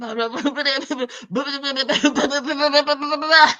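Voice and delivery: mock garbled babble, one long run; inaudible mumbling. Woman imitating his answer to Shirley, inaudible babbling. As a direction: imitate him babbling unintelligibly. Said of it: "it was inaudible".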